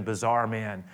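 ordinary delivery

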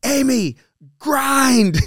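high, loud yell of encouragement; retold coaching yell. Coach yelling to a struggling Amy mid-match, high urgent shout. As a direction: yell it out to spur on a struggling fighter. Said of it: "you just yelled".

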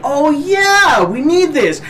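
mock excited discovery. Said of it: "we're like".

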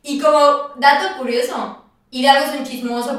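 ordinary talk

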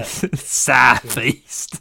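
mock Cockney, South East London accent; laughing South East London accent. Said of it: "Wow, it's like I'm there".

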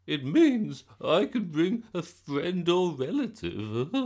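high, loud, slow gran voice; giddy gran bursting with news; very excited. A narrator's reading. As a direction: explain it giddily, stretching it out. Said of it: "never seen her so excited".

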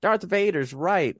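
high, slow mocking fan voice; mock fanboy. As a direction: parody the edgy fans. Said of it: "to be like".

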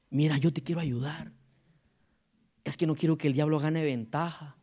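low, soft falsely caring voice; sweet gossip voice. Retold or imitated. Pastor mimicking a sweet-talking gossip to a brother, low and soft. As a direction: sound kind while spreading poison. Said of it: "Hasta lo dicen con una voz dulce".

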